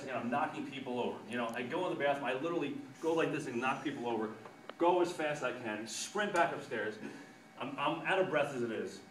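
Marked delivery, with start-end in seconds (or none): none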